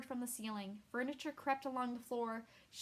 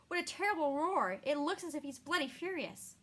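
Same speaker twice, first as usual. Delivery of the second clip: alarmed; 5 semitones higher, 5 dB louder than usual. Acted. Dandelion panics at the djinn.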